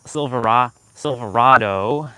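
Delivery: slow, loud Silverado with AH; drawn-out Silverado, third syllable like "rah"; own pronunciation. Shown as a demonstration. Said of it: "that's how I say it".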